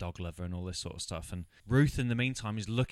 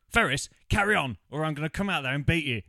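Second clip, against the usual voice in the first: louder and much higher, hammed-up threat; mock threatening husband; 6 semitones higher, 7 dB louder than usual. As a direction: bark the order from the wardrobe, playfully. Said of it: "is like".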